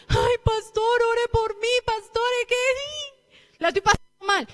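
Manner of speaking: high, pleading mock whine; whining churchgoer. Preacher mocks a believer begging the pastor, in a high whine. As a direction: imitate a self-pitying believer begging the pastor.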